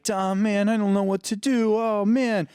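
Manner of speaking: high, loud, rushed panic; frantic. Retold or imitated. Jesse panicking to Dom after the race, frantic and loud. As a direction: blurt it out in a frantic rush. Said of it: "he's frantic".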